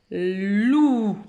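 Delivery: "loup" as one long, loud "lllouuu"; blended syllable. Shown as a demonstration. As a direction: stretch the L of "loup" straight into the vowel. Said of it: "on doit les lire ensemble". (« loup » lu lié, très étiré)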